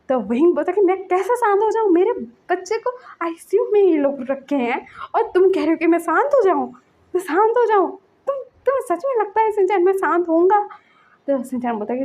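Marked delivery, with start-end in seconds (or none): 0.8-5.5 retold angry parent; angry disbelief. Parent refuses to calm down. 5.5-6.7 high, louder, bitter; indignant echo of मैं शांत हो जाऊँ. Retold or imitated. First angry quoting. 7.1-10.6 very high and loud; louder outraged repeat of मैं शांत हो जाऊँ; incredulous anger. Retold or imitated.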